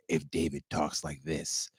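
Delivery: low, soft, slow snake-like hiss of a character; slithery snake voice. Pretend. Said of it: "He talks kinda like a snake".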